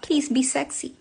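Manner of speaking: a hint of a sultry friend; mocking her request. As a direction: mimic her coy plea. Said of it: "she reminds me out all the time".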